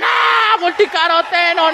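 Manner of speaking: high, stretched mock acclaim; mock awed cry. Imagined crowd greeting a soap product, mock ecstatic cry. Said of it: "Yo pensaba que a uno lo traían".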